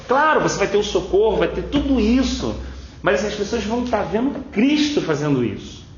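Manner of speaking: high, emphatic preaching, pressing each point; fervent preaching. Acted. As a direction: keep the pulpit heat up.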